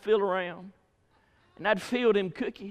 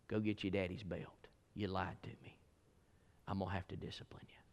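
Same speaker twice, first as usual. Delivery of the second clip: much softer, low and grave verdict; quiet stern mother; 9 semitones lower, 11 dB quieter than usual. Retold or imitated. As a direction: hand down the punishment quietly. Mama quietly pronounces punishment.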